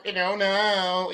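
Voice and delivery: ordinary talk, nothing marked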